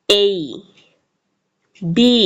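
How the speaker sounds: isolated এই, বি with drawn-out endings; correct letter names. Shown as a demonstration. Teacher models the alphabet for learners, careful letter names. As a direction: recite এই, বি one by one, carefully. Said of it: "অ্যালফাবেটের সঠিক উচ্চারণ এবং সাউন্ডগুলো জেনে নিই".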